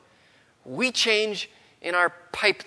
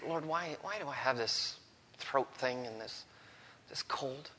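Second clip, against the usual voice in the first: soft complaining prayer; 2 semitones lower, 13 dB quieter than usual. Retold or imitated. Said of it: "I would say".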